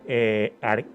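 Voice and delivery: normal talking voice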